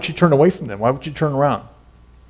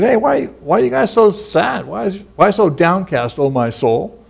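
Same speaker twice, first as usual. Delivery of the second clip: higher, gentle questioning voice; lightly voiced concerned stranger; kindly stranger; 7 semitones higher than usual. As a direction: ask the sad travellers kindly. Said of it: "And he goes".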